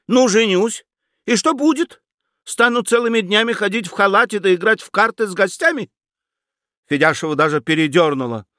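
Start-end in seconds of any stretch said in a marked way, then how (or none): 0.1-5.9 high, fast incredulous rant; indignant outburst. A narrator's reading. Nephew rants to aunt about marriage, high and fast. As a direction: scoff at married life, climbing high.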